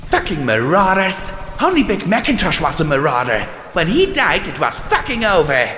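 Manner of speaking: high, fast bitter rant; angry rant. Acted. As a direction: spit out the grievance. Soldier mare ranting.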